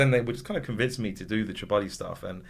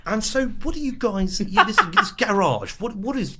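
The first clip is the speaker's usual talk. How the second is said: higher put-on posh student interviewer; student interviewer asking rappers, in a higher put-on voice; uni boy voice; 11 semitones higher than usual. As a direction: play the clueless uni boy, pitched up. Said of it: "that voice is me".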